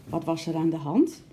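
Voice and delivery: ordinary delivery, nothing marked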